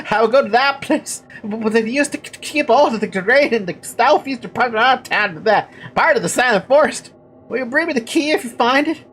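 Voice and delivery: high, halting villager stammer; pleading simpleton with a put-on stutter; stuttering character voice. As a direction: trip over first sounds in a high, eager voice. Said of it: "That's really hard to do".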